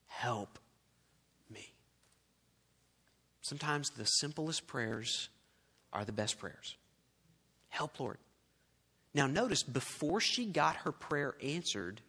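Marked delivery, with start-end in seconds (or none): 0.1-1.7 soft, low, halting prayer; quiet plea. Acted. Kneeling woman asking Jesus for help, very quiet. 7.7-8.2 very soft, brief plea; simple prayer. Acted. Preacher showing the simplest prayer, softly spoken.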